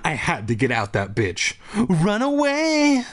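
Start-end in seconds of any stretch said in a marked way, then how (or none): 1.8-3.0 drawn-out high frantic cry; panicked cry. A narrator's reading. Narrator's panicked inner cry.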